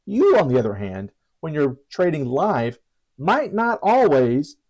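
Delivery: usual speaking voice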